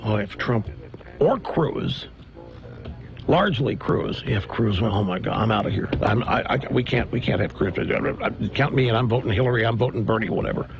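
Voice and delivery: lightly voiced disgusted insider; mock grumbling Republican. Imitating anonymous Republican leakers.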